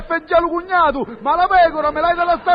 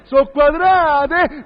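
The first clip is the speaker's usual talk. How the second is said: slow outraged; much slower than usual. Acted. Outraged conclusion.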